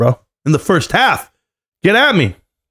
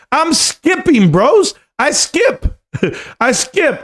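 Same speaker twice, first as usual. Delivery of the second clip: high, giddy and slow; 10 semitones higher, much slower than usual. Pretend. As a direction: sing it out like a giddy kid. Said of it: "I walk around floating".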